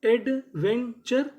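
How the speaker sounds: एडवेंचर stretched slowly, with a rising end; teacher modelling एडवेंचर; slow drawn-out demo. Shown as a demonstration. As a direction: sound out एडवेंचर slowly for the class. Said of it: "तो पूरी meaning क्या बनी?".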